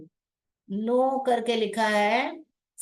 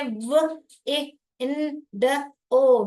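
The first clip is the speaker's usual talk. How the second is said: slow, louder letter-by-letter dictation; dictating letters; 3 semitones higher, 4 dB louder, much slower than usual. Shown as a demonstration. Teacher dictating spelling to the class. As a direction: spell it out slowly. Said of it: "blend करके लिखिए".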